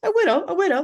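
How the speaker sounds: high, loud Kiwi voice; New Zealand accent. Pretend. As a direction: sing it out with a Kiwi lilt. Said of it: "the New Zealand accent".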